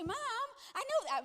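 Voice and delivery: high, loud mock teenage whine; exasperated son. Son fed up with mom's questions, whiny. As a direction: whine it, stretching out Mom.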